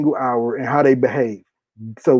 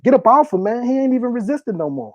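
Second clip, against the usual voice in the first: higher, urgent mock yell at the cops; retold bystander yell; 8 semitones higher than usual. Bystanders yelling at officers pinning a man, higher urgent call. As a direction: plead with officers to get off the man.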